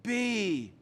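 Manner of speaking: high, loud dramatic African dad; father grandly sending his son forth; dad's voice. Retold or imitated. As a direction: proclaim it like a wise elder. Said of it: "That's how my dad talks".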